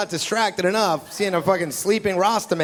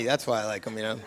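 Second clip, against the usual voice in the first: ordinary talk, nothing marked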